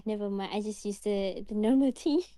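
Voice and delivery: normal talking voice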